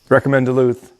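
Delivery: normal talking voice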